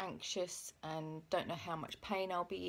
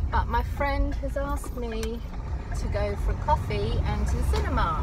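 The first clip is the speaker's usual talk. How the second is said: loud over road noise; 3 semitones higher, 13 dB louder than usual. Real, not acted. Update recorded in noisy car.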